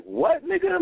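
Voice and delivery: high-pitched incredulous retort; mock disbelief. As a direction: imitate their stunned comeback, voice leaping up. Others' stunned reaction acted out.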